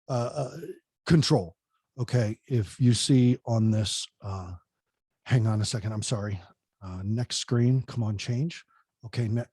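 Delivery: low, soft, distracted muttering at the slides; fumbling presenter. Shown as a demonstration. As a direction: play a speaker lost in his own clicker. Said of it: "this is what happens".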